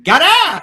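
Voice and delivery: "Gutter!" barked loud and high, gangster style; thirties gangster voice. Retold or imitated. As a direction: pop "Gutter!" out in old Bowery slang. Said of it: "all of a sudden".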